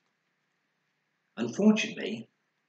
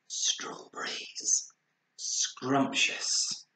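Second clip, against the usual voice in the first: savouring whisper; 3 semitones higher, much slower than usual. A narrator's reading. Bernard tasting rainbow arcs.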